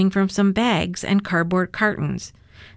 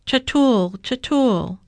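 sobbing; 5 semitones higher, slower than usual. A narrator's reading. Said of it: "Avi sobbed in Hebrew".

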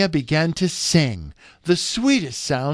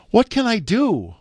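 high-pitched wondering question; 9 semitones higher than usual. A narrator's reading. Princess wonders how to reach the blind prince, wondering. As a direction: ask it high, puzzled what to do. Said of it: "wondered Maya".

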